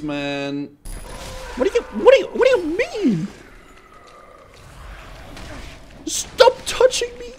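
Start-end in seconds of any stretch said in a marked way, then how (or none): 1.6-7.3 very high panicked squeal; panicked shriek. Real, not acted. Panic screaming at enemies.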